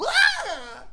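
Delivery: a quick high-pitched mocking yell. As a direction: throw out a silly high "Ah!". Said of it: "threw the fucking pole down on the ground and went".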